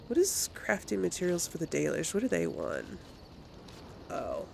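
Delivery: usual speaking voice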